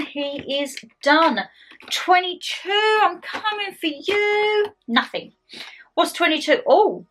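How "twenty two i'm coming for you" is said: slow playful menace; spooky. Pretend. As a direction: creep in slowly, teasing.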